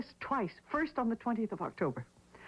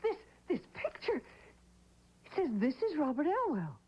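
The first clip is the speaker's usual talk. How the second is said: shocked recognition; 8 semitones higher than usual. Acted. Widow seeing the dead man's photo, a high shocked cry. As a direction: voice leaps high, stunned.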